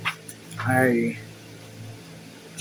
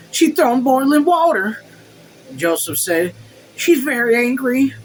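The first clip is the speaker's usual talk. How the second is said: much higher, louder flustered servant voice; alarmed servant; 9 semitones higher, 4 dB louder than usual. A narrator's reading. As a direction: blurt it out, panicked, pitched way up. Servant Joseph bursts in alarmed.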